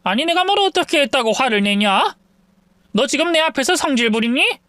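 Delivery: mother-in-law snapping back at the daughter-in-law; defensive mother-in-law. Acted. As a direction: bristle at being scolded by her.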